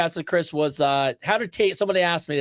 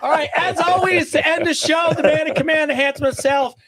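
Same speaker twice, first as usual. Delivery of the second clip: announcer-style introduction; 9 semitones higher, 4 dB louder than usual. Real, not acted. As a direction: build up the co-host like an MC. Hands over to co-host with grand introduction.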